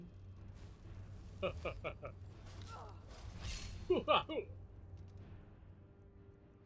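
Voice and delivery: unvoiced giggling; long breathy laugh. Real, not acted. Streamer laughing at their own bit, breathy. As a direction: lose it laughing.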